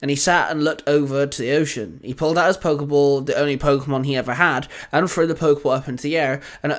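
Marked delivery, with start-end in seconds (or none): none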